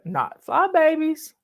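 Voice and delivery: ordinary talk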